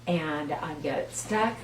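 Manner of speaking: ordinary delivery, nothing marked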